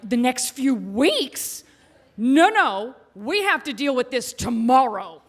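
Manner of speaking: emphatic, drawn-out disbelief; mock-indignant protest, key words stretched; incredulous objection. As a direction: balk at the delay, loud and insistent. Retelling her indignant objection to husband.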